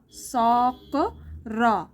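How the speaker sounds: very slow, सॉक and र said apart; broken into parts. Shown as a demonstration. Teacher to Assamese learners, sounding out the parts very slowly. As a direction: spell out सॉक र piece by piece, slowly. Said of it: "तो आपको ऐसे पढ़ना है".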